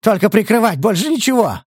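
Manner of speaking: high, desperate insistence; crying out. A narrator's reading. Scout under interrogation, crying out desperately. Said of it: "выкрикнул разведчик".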